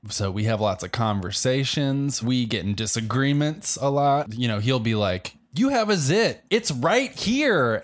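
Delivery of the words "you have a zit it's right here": high, teasing voice for the mirror; mirror reflection talking back. Pretend. The bathroom mirror tells him he has a zit, teasing. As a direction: point out the zit like a snide reflection.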